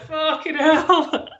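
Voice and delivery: drawn-out, high laughing curse; slow giggling groan of dread; laughing through it. Retold or imitated. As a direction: laugh the words out slowly. Retold dread, laughing at self.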